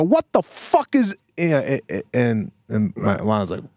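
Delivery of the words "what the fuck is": retold outburst. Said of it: "And I go".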